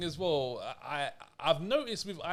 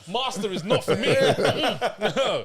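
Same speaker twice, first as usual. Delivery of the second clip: laughing; 5 semitones higher, 6 dB louder, slower than usual. Real, not acted. Laughing refusal.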